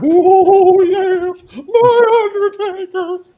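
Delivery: very high, loud, drawn-out wrestling-manager wail; Paul Bear. Acted. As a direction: let it soar into a throat-tickling, theatrical howl. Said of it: "Good Paul Bear voice".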